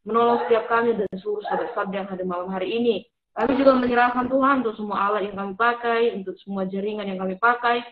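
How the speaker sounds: earnest spoken prayer. Acted. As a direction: pray aloud, sincere and measured. Opening prayer for the session. (doa yang khusyuk)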